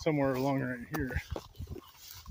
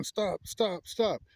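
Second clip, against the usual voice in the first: mild mock yell, raised in pitch; higher-pitched, half-hearted retold shout; 6 semitones higher, much slower than usual. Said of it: "And I'm yelling".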